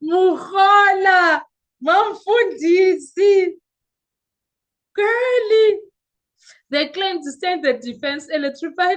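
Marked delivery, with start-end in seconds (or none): 0.0-3.5 high, loud, slow cry of the name; outraged shout. Real, not acted. Vlogger calling out the officer in outrage. 4.9-5.8 exclamation. Real, not acted. Vlogger exclaiming in outraged disbelief.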